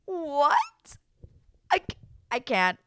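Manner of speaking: high, loud, broken-off disbelief; speechless shock. Acted. Recapper lost for words at the news, speechless shock. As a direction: squeak "what", then give up on words.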